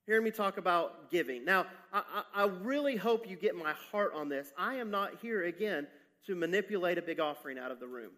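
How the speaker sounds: ordinary delivery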